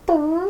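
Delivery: mock fart noise with the lips; imitated fart noise. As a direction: blow a quick raspberry like a fart. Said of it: "I'd be like".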